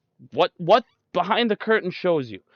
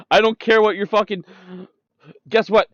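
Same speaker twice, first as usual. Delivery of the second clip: normal talking voice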